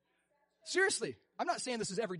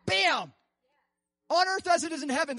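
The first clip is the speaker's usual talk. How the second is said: high, louder declaration; preaching at a peak; emphatic proclamation; 8 semitones higher, 6 dB louder than usual. Real, not acted. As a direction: punch it out with conviction. Preacher's exclamation.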